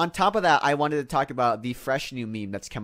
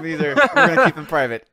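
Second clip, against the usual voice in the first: laughing quote; 10 semitones higher, 9 dB louder, faster than usual. Retold or imitated. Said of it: "I was like".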